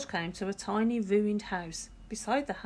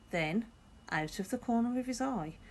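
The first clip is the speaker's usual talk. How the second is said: ordinary delivery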